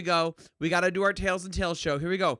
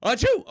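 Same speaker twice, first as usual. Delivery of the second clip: fake sneeze; 7 semitones higher, 9 dB louder than usual.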